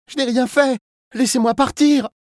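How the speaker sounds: put-on very high, loud child voice begging; panicked child's plea. A narrator's reading. Kidnapped boy begging to be let go, panicked pleading. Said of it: "implora l'enfant en panique".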